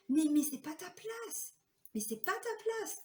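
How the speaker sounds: high, slow, pleading inner voice; insistent inner voice. Retold or imitated. She tells herself she does not belong there, high and slow. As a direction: let the pitch climb as you insist.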